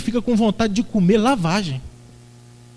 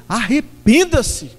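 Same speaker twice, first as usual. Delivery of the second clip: slow, louder drawn-out command; general's voice; 3 semitones higher, 4 dB louder, much slower than usual. Retold or imitated. God commanding the listeners to repent, like a general. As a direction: order it like a general, not an invitation. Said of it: "com voz de general".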